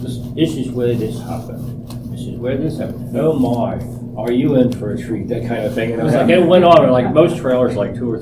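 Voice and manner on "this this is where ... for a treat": slurred, deliberate tour-guide impersonation; Alfred Hitchcock. Retold or imitated. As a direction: play the showman guiding visitors round his sets. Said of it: "takes you around all the sets and goes".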